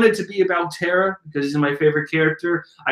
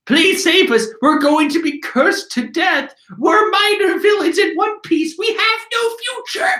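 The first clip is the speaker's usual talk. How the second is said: much higher, whiny pleading minions; panicked minion voice. Acted. Minor villain ninjas beg to be saved, high and whiny. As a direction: plead for rescue in a high comic whine. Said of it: "it's like".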